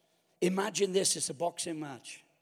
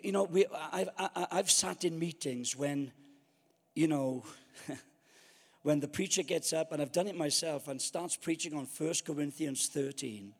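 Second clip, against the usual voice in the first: usual speaking voice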